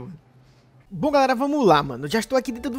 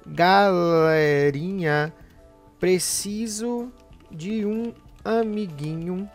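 slowly reading the post aloud; drawn-out, spelled-out reading; 6 dB louder, much slower than usual. Acted.

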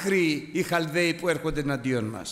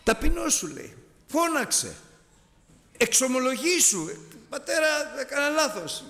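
high, insistent coaxing; father urging the son, high and insistent; 11 semitones higher than usual. Retold or imitated. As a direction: press the child to call out and confess.

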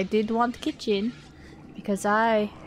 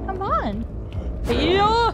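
excited taunt; 9 semitones higher, 3 dB louder, much slower than usual. Real, not acted. Baiting the enemy.

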